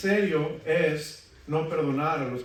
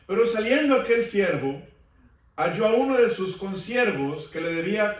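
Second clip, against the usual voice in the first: higher, lifted preaching; fervent sermon reading; 5 semitones higher, 5 dB louder than usual. Acted. Preacher reading the parable to his congregation, fervent. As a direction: drive the parable forward.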